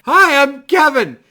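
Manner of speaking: high, loud, slow introduction; starstruck greeting. Retold or imitated. Starstruck fan to lead guitarist, loud eager greeting. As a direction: blurt your name out eagerly, drawn out.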